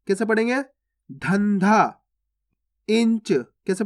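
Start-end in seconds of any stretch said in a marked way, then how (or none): none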